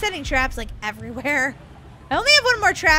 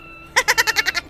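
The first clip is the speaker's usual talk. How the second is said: higher-pitched villain cackle; evil laugh; 6 semitones higher than usual. Pretend. [name] as evil Piggy hunts Daisy, evil laugh. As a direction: cackle like a cartoon baddie. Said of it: "you are evil Piggy".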